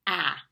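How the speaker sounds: ah said short and loud like the a in apple; short a sound. Shown as a demonstration. Teacher modeling the short vowel sound, a clear ah. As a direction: open the mouth wide for ah. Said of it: "the sound is".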